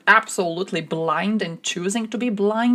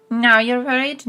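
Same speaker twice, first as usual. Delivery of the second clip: louder, higher, mocking disbelief; louder sarcastic jab; 5 semitones higher, 5 dB louder than usual. Real, not acted. Mocking the Serbs' late worry, louder sarcastic jab. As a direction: throw it back at them, louder.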